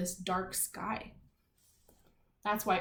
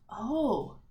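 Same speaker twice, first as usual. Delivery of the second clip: whispered oh. Real, not acted. Teacher noticing something, whispering oh. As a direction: realize it under your breath.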